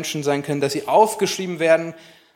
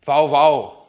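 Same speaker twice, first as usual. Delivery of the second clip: put-on child barking like a dog; dog bark imitation; 4 dB louder than usual. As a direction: woof it like a little kid. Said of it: "kann Geräusche nachmachen".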